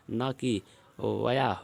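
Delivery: "वया" stretched slowly to show the wrong form; slow wrong-form demo. Shown as a demonstration. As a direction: drag out "वया" as the mistake. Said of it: "सबसे सही यहाँ पे अत्यधिक है". ("वया" को धीरे खींचकर गलत रूप दिखाना)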